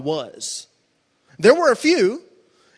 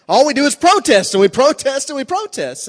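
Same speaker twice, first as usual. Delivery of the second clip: louder, higher, repeated hammering of protest; preacher jabbing at church-hopping protestants; building emphasis; 8 semitones higher, 7 dB louder than usual. Acted. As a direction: pound the word protest each time.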